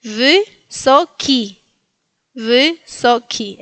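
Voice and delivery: "Wysoki" slowly in three separated syllables; slow "Wysoki": vy near E, final ki a clear I; Y versus I. Shown as a demonstration. Teacher lets learners hear difference, slow split "Wysoki". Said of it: "Ven la diferencia entre la Y y la I".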